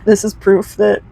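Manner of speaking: ordinary delivery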